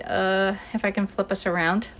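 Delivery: ordinary talk, nothing marked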